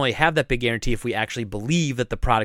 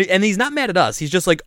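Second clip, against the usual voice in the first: ordinary talk, nothing marked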